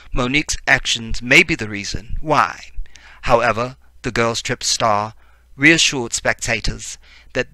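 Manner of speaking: mock BBC newsreader in a put-on British accent; fake British accent. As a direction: read the story out with a playful fake British accent. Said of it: "let's just do British".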